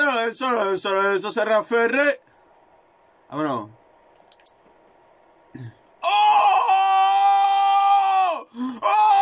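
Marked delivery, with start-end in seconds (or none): 6.0-9.2 high loud shout with a laugh; yelling oh with a laugh; excited shout. Real, not acted. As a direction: let out a big burst of delight. Reacting to a goal.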